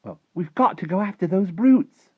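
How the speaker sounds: put-on high, loud insistent girl's voice; indignant, loud plea to act; urgent girl. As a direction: urge him on, pitched high and loud. Sophie urging the giant to act.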